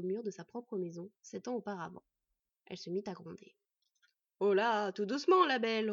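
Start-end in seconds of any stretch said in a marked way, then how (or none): 4.4-5.9 mock-calm her with a sneer. Bandit taunting the growling wolf, sneering. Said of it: "reprit l'homme avec un rictus".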